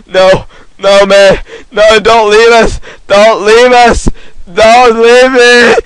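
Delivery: loud, high, drawn-out wail; hammed-up pleading. Gamer begs teammates not to leave, mock-desperate shouting. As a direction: beg loudly, as if being abandoned. Acts abandoned in game.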